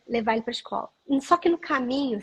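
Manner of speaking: ordinary delivery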